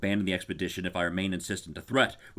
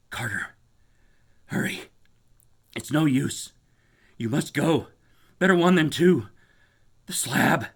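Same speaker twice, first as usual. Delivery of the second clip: higher, louder urgent pleas; urgent whisper; 6 semitones higher, 6 dB louder than usual. Acted. Warren urging Carter to leave, urgent. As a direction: push him away urgently. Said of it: "his next whisper".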